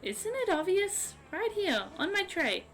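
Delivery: appalled princess of the sea; hammed-up offended mermaid; indignant mermaid girl. Voices Miranda, mermaid girl.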